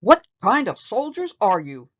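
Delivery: loud exasperated drill shout. A narrator's reading. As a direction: shout down the sloppy line. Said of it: "he shouted".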